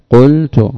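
قلت said loud, a clear u on the qaf; teacher underlining the result loudly; emphatic قلت. Shown as a demonstration. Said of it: "وأنها واو".

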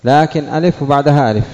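normal talking voice

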